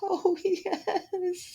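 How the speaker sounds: high, soft, tearful yes through sobs; sobbing. A narrator's reading. Cinderella answering her godmother, high and sobbing. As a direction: gulp out the yes between sobs.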